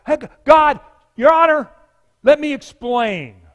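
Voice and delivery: loud, high courtroom appeal; best lawyer voice. Acted. Sinner cries out to God at judgment, in lawyer voice. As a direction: cry out like a lawyer pleading. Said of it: "in his best lawyer voice, he cries out".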